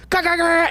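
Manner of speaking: high rapid-fire "ga-ga-ga"; imitated crowd uproar. Comic retelling the host's killer set, imitated uproar. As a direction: rattle out a high mock noise of a show going wild. Imitates roaring laughter at the show.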